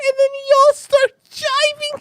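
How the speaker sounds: high, slow, drawn-out mock lady voice; mimicking the woman. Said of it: "she said".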